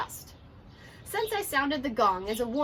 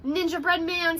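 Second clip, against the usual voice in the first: higher little squeak; squeaky mouse; 5 semitones higher, 4 dB louder than usual. Acted. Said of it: "squeaked the Ninja Mouse".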